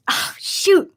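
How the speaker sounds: breathless, choked-up, high "oh, shoot"; catching a sob before it breaks through; tearful gasp. Real, not acted. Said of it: "I do not wanna cry on stream".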